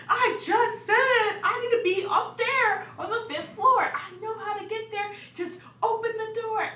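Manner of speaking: high, insistent angry outburst; retold going off. Labouring woman to confused receptionist, retold angry outburst. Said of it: "I'm just going off".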